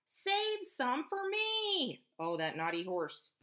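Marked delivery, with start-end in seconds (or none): 0.0-2.0 high, slow drawn-out plea; farmer pleading. A narrator's reading. Farmer begging the horse, slow high plea. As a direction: stretch it out begging. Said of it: "cried the farmer".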